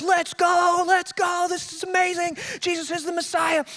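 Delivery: high, eager retelling of pumped followers; excited disciples. Retold or imitated. Preacher acting out disciples thrilled that Jesus is the Messiah, eager and high. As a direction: voice them cheering that their hero has arrived. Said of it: "They're excited".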